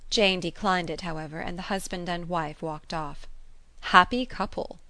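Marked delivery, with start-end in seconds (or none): 3.9-4.8 higher, louder ironic exclamation; lightly mocking praise of the couple; mock admiration. A narrator's reading. Mocks the departed couple.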